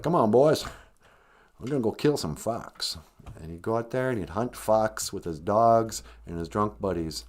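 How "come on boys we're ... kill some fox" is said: lightly mimicked Southern US hunting accent; rally the hunters with a hint of Southern US twang; Prince Harry. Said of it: "he's got a southern accent when he's hunting".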